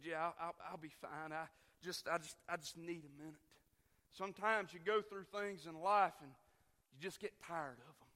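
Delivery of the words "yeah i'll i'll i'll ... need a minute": soft, low, halting voice of a hurt wife; imitating tearful wife. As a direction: quote her quietly, stumbling to reassure. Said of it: "sitting there with tears in her eyes".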